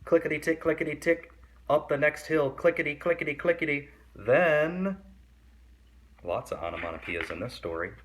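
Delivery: put-on lively read-aloud for kids; story reading. A narrator's reading. Reading a picture book to kids, lively storytelling. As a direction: read the picture book to young listeners, animated.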